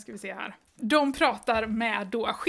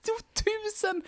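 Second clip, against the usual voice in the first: Gunnar behind his hands; 9 semitones higher, 4 dB quieter, slower than usual. Acted. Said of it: "säger Gunnar med händerna för munnen".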